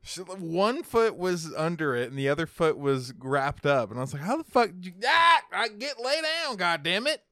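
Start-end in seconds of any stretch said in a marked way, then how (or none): 4.2-7.2 high, loud exasperated yelling; retold angry outburst. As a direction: re-enact yelling at the dog, pissed off. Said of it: "it pissed me off".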